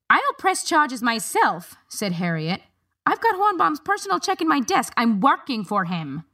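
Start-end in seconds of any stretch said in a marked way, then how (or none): 0.1-1.8 higher, slow, firm retort; defiant woman. A narrator's reading. Harriet defiant. 3.0-6.2 much higher, urgent protest; indignant protest. A narrator's reading. Harriet protesting.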